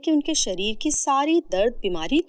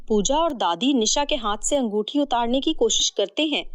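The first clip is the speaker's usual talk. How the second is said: ordinary delivery, nothing marked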